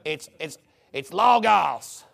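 mock fake Greek accent, slow LAW-gahs; put-on Greek accent, stretched and affected. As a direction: draw out "logos" in a phony Greek accent. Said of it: "just like your fake accent".

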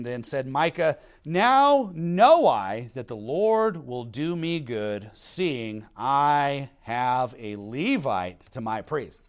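slow, weighty Bible reading; deliberate, spaced-out recitation; scripture reading. Acted. Reads scripture aloud.

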